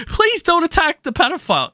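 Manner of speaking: high mocking whine; mocking whiny voice. Mocking the tweet he quotes, high whiny sneer.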